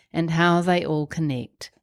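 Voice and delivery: normal talking voice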